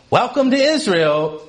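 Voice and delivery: high-pitched cabin welcome; imitated flight attendant greeting; flight attendant announcement. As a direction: announce it to arriving passengers. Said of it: "flight attendant gets on the speaker".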